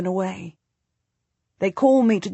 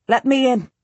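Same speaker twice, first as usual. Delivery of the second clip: higher, raised command; calling out; 5 semitones higher, 4 dB louder than usual. A narrator's reading. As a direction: call up to be let in. Demanding entry at the gate.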